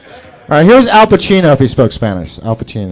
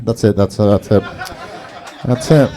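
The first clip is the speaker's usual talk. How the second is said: ordinary talk, nothing marked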